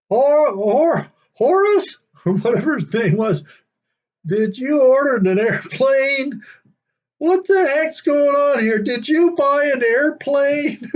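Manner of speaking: high, loud, drawn-out call to her husband; calling out baffled. Retold or imitated. Wife calls her husband out about the airplane, high loud and baffled. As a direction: shout for him in high disbelief.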